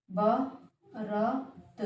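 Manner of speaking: बारात drawn out very slowly and quiet; slow soft dictation. Shown as a demonstration. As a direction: stretch बारात slowly, softly. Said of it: "बारात होता है".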